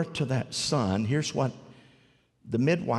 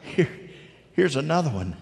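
normal talking voice

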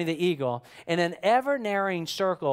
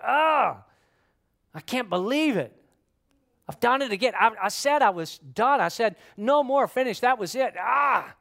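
higher-pitched exasperated outburst; angry at oneself; 4 semitones higher than usual. Acted. Person angry at himself for relapsing, frustrated and pitched up. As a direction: groan and berate yourself in frustration. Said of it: "You get angry with yourself".

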